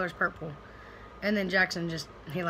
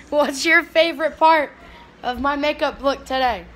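loud, raised-pitch question; calling out; 5 semitones higher, 9 dB louder than usual. Real, not acted. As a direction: project the question across to someone.

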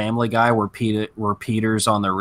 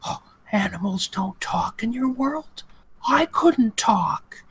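imitating ASMR girl; 14 semitones higher, slower than usual. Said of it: "And it was all like".

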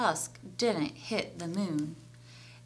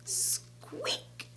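tiny hushed squeak, drawn-out start; whispered mouse squeak; 12 dB quieter than usual. A narrator's reading. Storyteller voices the mouse after the snap, whispered squeak.

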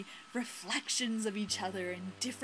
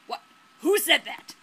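loud, higher-pitched acted question; raised-voice fisherman's challenge; startled demand; 5 semitones higher, 9 dB louder than usual. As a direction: call out to an unseen speaker. Startled fisherman demands who spoke.

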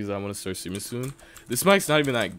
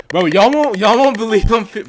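high, loud, insistent outburst; loud protest; 12 semitones higher, 16 dB louder than usual. Real, not acted. Streamer to chat who won't believe him, loud protest. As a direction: argue it at full volume, voice pitched up.

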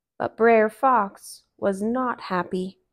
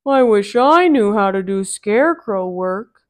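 Brer Bear; 8 dB louder than usual. Acted. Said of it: "said Brer Bear".

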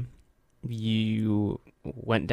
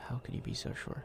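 spooky whisper; creepy whisper; 16 dB quieter than usual. Pretend. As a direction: whisper it to unsettle her. Teasing partner in horror game.

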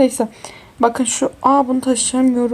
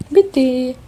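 normal talking voice

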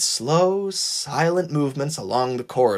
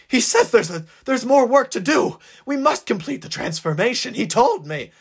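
frantic fanatic; 9 semitones higher than usual. Acted. Man in frantic explanation.

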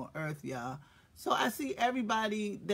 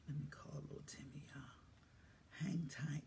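whisper; 4 semitones lower, 15 dB quieter than usual. Real, not acted. Whispering aside while calling Timmy.

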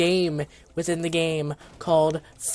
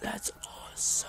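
soft, breathless whisper; awed whisper; 15 dB quieter than usual. Real, not acted. Gamer watching the goat summon, awed whisper. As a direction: whisper it in quiet awe.

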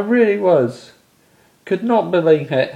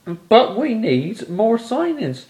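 ordinary talk, nothing marked

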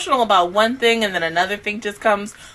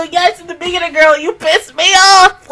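louder, higher scolding; mock scolding; 7 semitones higher, 8 dB louder than usual. Real, not acted. Scolding character on screen.

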